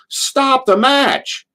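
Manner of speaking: high, long-drawn mock yell; imitated heckling yell. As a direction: holler it high and stretched, as a retold heckle. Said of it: "and I'd yell".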